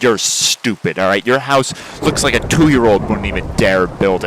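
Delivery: loud, heated trash talk; angry insults. Acted. Trading insults with neighbor Buckle, loud and angry. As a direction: spit the insults at him loudly. Acted quarrel with neighbor chicken.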